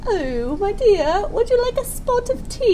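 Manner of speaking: put-on high posh British lady accent.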